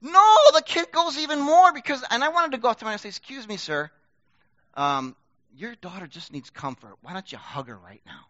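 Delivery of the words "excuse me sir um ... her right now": polite hush. Retold or imitated. Imagined polite word to father.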